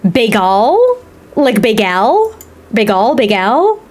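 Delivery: "Bagel" drawn out with a stretched, questioning second syllable; unsure, stretched "bagel?" with a long open ending; mispronounced bagel. Shown as a demonstration. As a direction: try "bagel" as bay-GAL, slowly, rising at the end.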